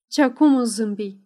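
ordinary delivery, nothing marked

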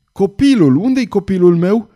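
high, loud desperate call; frantic cry. A narrator's reading. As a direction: cry out in panic for the child. Father frantic for his child. (strigăt disperat)

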